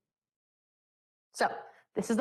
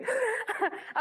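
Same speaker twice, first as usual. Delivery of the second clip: light laugh; 9 semitones higher, 4 dB quieter than usual. Real, not acted. Laughs after teasing student.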